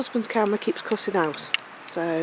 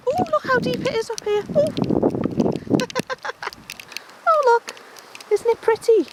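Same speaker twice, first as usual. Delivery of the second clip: excited; 13 semitones higher than usual. Real, not acted. Delighted by deep snow.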